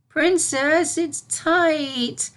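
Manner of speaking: high filly voice, whiny; a filly squirming in a snug ring; complaining filly. A narrator's reading. Filly complaining to Celestia about the ring, whiny. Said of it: "complained the filly".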